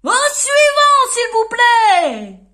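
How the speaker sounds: booming shopkeeper call; grocer shouting. Acted. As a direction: bellow for the next customer. Grocer dismisses customer.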